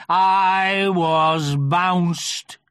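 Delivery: very slow, heavy donkey voice; gloomy Eeyore voice. A narrator's reading. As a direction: drag it out mournfully. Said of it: "said Eeyore".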